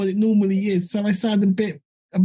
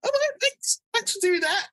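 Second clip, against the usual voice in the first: laughing; 12 semitones higher, 4 dB quieter than usual. Real, not acted. Laughing at the host's analogy.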